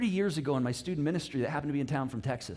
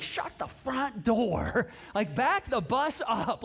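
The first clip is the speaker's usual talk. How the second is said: drawn-out, higher-pitched comic shock; mock disbelief; 5 semitones higher, much slower than usual. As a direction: stretch each word in hammed-up astonishment. Acts husband's disbelief at the news.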